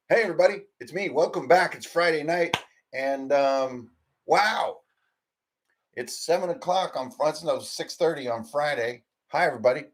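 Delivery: loud, high, bubbly welcome; excited greeting. Real, not acted. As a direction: greet the viewers full of energy.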